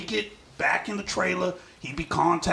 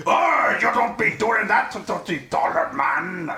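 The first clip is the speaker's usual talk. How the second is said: slow, hammed-up Irish big man; badly faked burly Irish voice; 3 dB louder, slower than usual. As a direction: put on a big Irishman's drawn-out scolding. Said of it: "imagine this in big man Irish voice".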